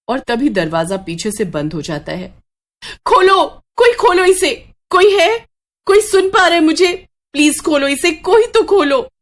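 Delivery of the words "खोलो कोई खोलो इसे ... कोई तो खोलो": much higher, louder, desperate cries for help; panicked screaming. Acted. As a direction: yell for someone to open the door. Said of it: "शीतल जोर जोर से चिल्ला रही". (डरकर जोर से चिल्लाना)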